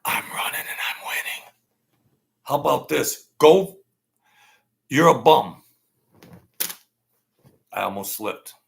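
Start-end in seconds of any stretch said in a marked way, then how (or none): 0.0-1.5 soft breathy mocking whisper; hushed parody whisper; mock Biden whisper. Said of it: "when he whispers something". 3.4-5.6 loud taunting yell; mock shout. Shouting at Biden.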